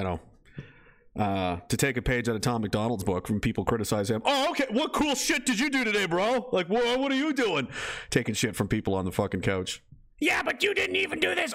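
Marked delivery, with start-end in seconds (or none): 4.2-7.7 high-pitched sarcastic comeback; mocking, incredulous challenge; mock retort. 10.2-11.5 very high mocking heckler voice; hammed-up high-pitched complaint; whining critic. Mimics a couch critic.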